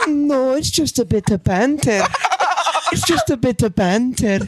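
high, slow Scottish lilt; Scottish woman. Shown as a demonstration. Playing the Baby Reindeer woman for laughs, high Scottish accent. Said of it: "do the Scottish chick from that fucking".

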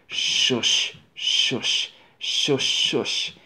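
soft hissing shush of steam; whispered engine shushes, dejected; mournful quiet shush. Acted. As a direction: let the steam out sadly and quietly. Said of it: "a mournful, quiet shush".

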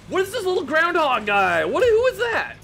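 high, loud surprised squeal. Real, not acted. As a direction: react in sudden shock. Surprised at new creature.

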